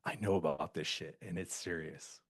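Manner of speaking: low, quiet, ominous warning; hushed grave voice. Retold or imitated. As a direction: speak it low like a secret. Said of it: "She was just like".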